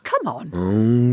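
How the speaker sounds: low, droopy Eeyore voice; low Eeyore demo. Shown as a demonstration. Host showing the guest how low Eeyore sounds. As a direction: drop the voice down for Eeyore. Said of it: "just lower your voice".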